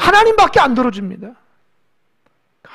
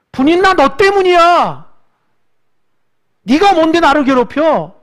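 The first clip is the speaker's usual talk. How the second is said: higher, louder, accusing rival wife; mock angry confrontation; 6 semitones higher, 7 dB louder than usual. Hannah scolding Peninnah, in a voiced angry outburst. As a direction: hurl the blame at her rival.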